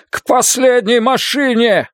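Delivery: high, drawn-out urgent yell; slow, high-pitched shout to the group; shouted command. A narrator's reading. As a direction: shout directions over gunfire. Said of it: "выкрикнул Йонас".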